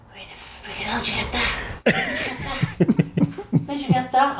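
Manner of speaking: high-pitched mock outrage; incredulous demand. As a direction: act out a baffled, indignant demand. Acting out man demanding where it came from.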